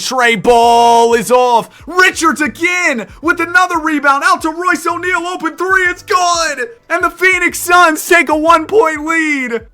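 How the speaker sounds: loud, high play-by-play yell; excited shouting call. Acted. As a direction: build the call to a roar. Go-ahead three, shouted call.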